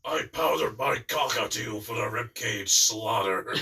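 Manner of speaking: slow, loud psycho rant; shouting Krieg the Psycho. Acted. Contestant quoting another psycho line, loud slow deranged shout. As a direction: holler it like a madman. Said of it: "he keeps shouting stuff like".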